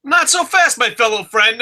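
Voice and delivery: booming game-day pundit tease; Lee Corso. Retold or imitated. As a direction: ham up a TV football analyst's catchphrase. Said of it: "The first Lee Corso in years".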